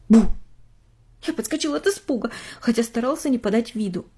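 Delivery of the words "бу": sudden loud boo; jump-scare shout; startling boo. Acted.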